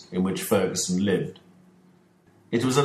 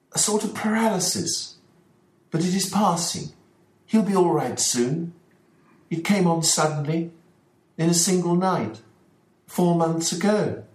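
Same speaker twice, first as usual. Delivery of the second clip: much higher, anxious gentleman's voice; worried father Ferguson; 10 semitones higher than usual. Acted. Ferguson answering Holmes about the dog, higher and uneasy. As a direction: play the troubled host explaining his dog.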